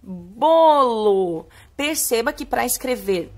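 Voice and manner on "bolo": "Bolo" loud and stretched; slow, sustained syllables, projected; drawn-out reading. Shown as a demonstration. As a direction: lead the class in reading it, long and loud. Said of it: "lê com a prof".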